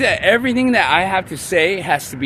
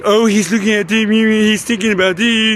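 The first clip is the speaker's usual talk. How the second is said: higher mimicking tattletale voice; accusing inner voice; 6 semitones higher, 4 dB louder than usual. Inner voice accusing the speaker over a thought, mock tattling. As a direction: mimic the accusing voice in your head. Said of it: "it's already saying".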